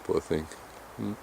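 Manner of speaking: ordinary talk, nothing marked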